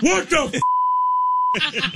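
high-pitched cry of disbelief; mock stunned outburst; astonished Japanese fan. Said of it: "But he was like".

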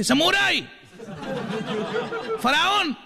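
very high yelled heckles; shouted cheers. Retold or imitated. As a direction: drunk fan yelling at singer. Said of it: "seguía gritando".

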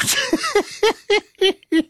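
high-pitched falsetto giggle. Real, not acted. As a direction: squeal with laughter. Giggling at his own bloopers.

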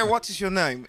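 "What is your name?" said high with a British lilt; imitating a British accent. Shown as a demonstration. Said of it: "es británico".

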